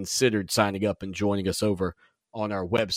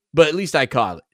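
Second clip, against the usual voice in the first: pleased with himself; 4 semitones higher, 6 dB louder than usual. Real, not acted. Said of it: "The brain, I tell you".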